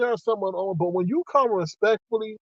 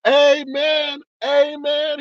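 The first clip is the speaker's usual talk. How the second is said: joyful amen; 7 semitones higher, much slower than usual. Retold or imitated. Rejoicing at getting only a warning, high joyful amen. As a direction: shout thanks like in church. Celebrating being let off.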